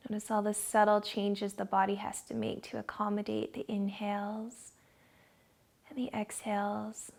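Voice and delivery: guided meditation. Acted. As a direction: ease them into calm, dropping to a whisper on the breath.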